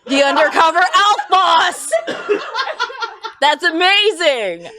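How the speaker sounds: loud and high with laughter; excited. Real, not acted. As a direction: burst out delighted. Thrilled by a story idea.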